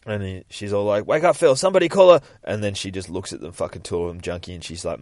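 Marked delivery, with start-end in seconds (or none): 1.0-2.2 high, loud acted alarm; urgent cry for help. Wife trying to rouse husband after crash, urgent cry. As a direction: call out urgently in a raised voice.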